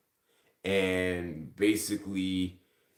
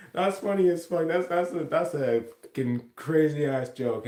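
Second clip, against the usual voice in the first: higher and louder, laughing it off as a joke; amused disbelief; 7 semitones higher, 4 dB louder than usual. Retold or imitated. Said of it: "And I was like".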